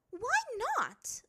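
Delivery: high, loud affronted question; insulted. A narrator's reading. Azula refused by the master, insulted. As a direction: demand a reason, offended. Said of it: "sounding somewhat insulted".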